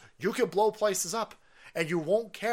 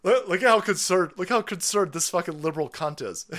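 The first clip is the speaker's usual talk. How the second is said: normal talking voice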